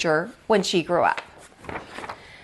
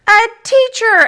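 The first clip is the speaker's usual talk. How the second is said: high, loud cheer of the whole class; class shout; 13 semitones higher, 8 dB louder than usual. Acted. The class answers Mister Slinger, a high, loud shout.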